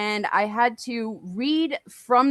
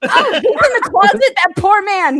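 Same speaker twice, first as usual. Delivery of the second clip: mock surprise; 10 semitones higher, 9 dB louder than usual. Mock shock, laughing.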